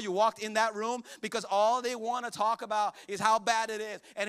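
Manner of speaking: loud, high, driving sermon delivery; full-force preaching. Acted. Pastor preaching to the church, loud and driving. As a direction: preach it hard to the congregation.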